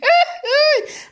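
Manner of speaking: sudden high laugh bursts out; burst of laughter. Real, not acted. Creator laughing aloud at the letter. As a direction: laugh hard at the silly letter.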